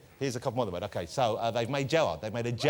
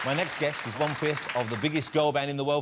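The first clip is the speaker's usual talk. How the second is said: showman guest introductions; 6 semitones higher, 5 dB louder than usual. Acted. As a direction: announce each guest like a big-night showman. Host introduces guests, shouting names.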